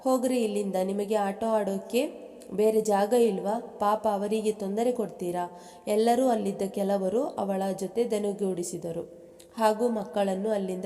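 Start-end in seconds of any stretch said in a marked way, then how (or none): none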